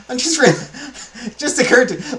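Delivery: high-pitched, breaking into laughter; too amused to finish the sentence; cracking up. Real, not acted. Speaker cracking up over what just occurred to them, laughing.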